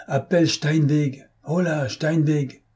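high, slow hailing call. A narrator's reading. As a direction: call to someone down a tube. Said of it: "Inutile de crier".

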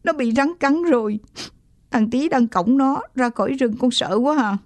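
scared, mildly weepy voice; tearful report. A narrator's reading. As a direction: report to dad through rising tears. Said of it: "Tôi bắt đầu khóc to".

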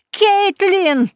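high, loud shriek of a name; furious scream. Acted. Cousin spotting Kaitlyn in the crowd, furious shriek. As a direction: shriek her name across the hall. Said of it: "Её крик разорвал".

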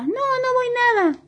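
negativa agudísima de juguete; niégate con vocecita finita y caprichosa; bolita caprichosa. A narrator's reading. La bolita se niega a salir. (berrinche de bolita de cuento)

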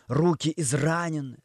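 plain reading voice

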